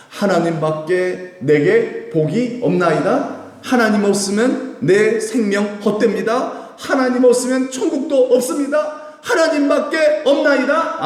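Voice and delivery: slow, high, loud fervent declaration; fervent confession. Acted. Preacher voicing a believer's confession, building fervent declaration. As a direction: proclaim it with rising fervor.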